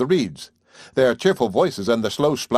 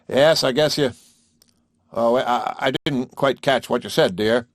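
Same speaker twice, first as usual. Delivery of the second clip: a bit louder, calling over. A narrator's reading. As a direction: raise the voice a little. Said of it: "and louder".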